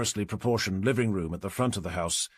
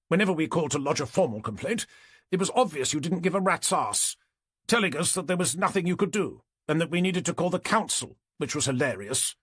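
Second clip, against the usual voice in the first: higher-pitched complaining neighbour; indignant neighbour; 6 semitones higher than usual. A narrator's reading. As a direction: pitch it up while listing grievances.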